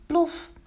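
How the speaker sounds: high, sudden plop; imitated impact. A narrator's reading. Pan lands on the spirit burner, high sudden plop. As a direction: pop out the sound of a falling pan.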